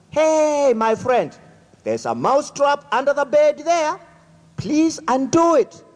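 a high, anxious little mouse begging his friend; pleading mouse. Acted. Mouse begs his friend for help.

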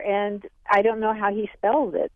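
ordinary delivery

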